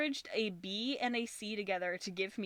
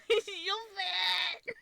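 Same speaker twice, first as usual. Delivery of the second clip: high, louder squealy cooing; squealing; 11 semitones higher, 5 dB louder than usual. Real, not acted. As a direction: coo at the cat. Cooing at cat photo.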